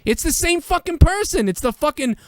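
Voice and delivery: high-pitched, worked-up ranting; heated insistence. Real, not acted. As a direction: insist it's the same guy, fired up. Heated sports argument.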